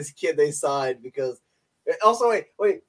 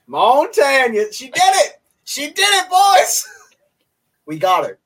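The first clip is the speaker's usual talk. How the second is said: much higher, louder mock cowboy cheer; cowboy accent celebration; 7 semitones higher, 7 dB louder than usual. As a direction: whoop it like a cowboy who just won. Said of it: "demo reel for a cowboy accent".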